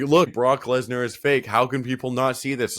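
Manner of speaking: mock-incredulous outburst; mocking fans' indignation. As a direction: play someone triumphantly pointing out the obvious. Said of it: "they try and point out people".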